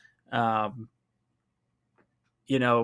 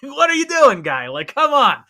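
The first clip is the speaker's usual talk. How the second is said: loud, high, exasperated yell; frustrated shout; 12 semitones higher, 8 dB louder than usual. Real, not acted. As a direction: shout at the screen in disbelief. Yelling at player on screen.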